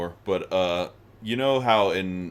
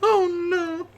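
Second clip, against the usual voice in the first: shocked laughing; 4 dB louder than usual. Real, not acted. Reacting to a dirty joke.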